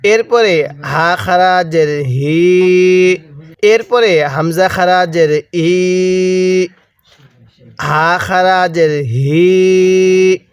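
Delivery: very slow, "হি" and "ই" held long; slow Quran-lesson drill with long endings; long drawn vowels. Shown as a demonstration. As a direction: chant the letters, stretching each final vowel. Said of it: "এটাকে হি পড়ছি".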